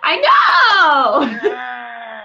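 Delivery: loud, drawn-out mock squeal; excited squeal. Telling the amazed wife she agrees, excited squeal. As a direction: cry it out in gleeful amazement.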